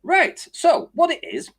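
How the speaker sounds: high, self-important opening statement; mock the know-it-all launching in; lightly voiced witness starting a speech; pompous Tony. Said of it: "So Tony goes".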